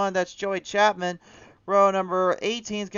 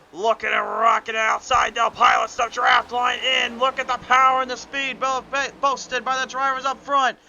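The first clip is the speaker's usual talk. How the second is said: a touch higher, more energetic race call; excited play-by-play; 4 semitones higher, 4 dB louder than usual. Acted. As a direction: lean in as the pack battles up front. Excited lap commentary.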